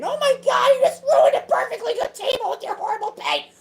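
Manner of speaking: high-pitched, loud hysterical complainer; mock outraged yelling. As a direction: yell like a scandalized nag, hammed up. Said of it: "anybody yelling at me saying".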